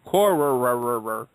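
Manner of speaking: Corrererererrer with a long rolling trill; mock rolled name. Shown as a demonstration. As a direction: roll the r on Corrererererrer over and over. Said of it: "I have a problem with the name of that team".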